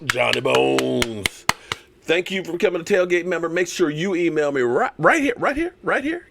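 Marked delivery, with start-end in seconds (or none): 0.0-1.5 drawn out. Real, not acted. Drawn-out shout-out to a viewer. 5.0-6.3 pointing it out. Real, not acted. Pointing viewers to the email.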